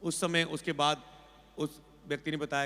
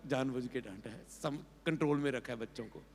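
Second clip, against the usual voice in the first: inner voice; 4 semitones lower, 7 dB quieter than usual. Retold or imitated. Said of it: "पर अंदर बोल रहा है".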